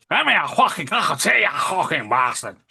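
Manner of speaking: lightly put-on gruff Scottish, quick and pushy; mock rough Scottish. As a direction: fire it off like an angry Scot, only half-done. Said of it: "What Laura will have heard was".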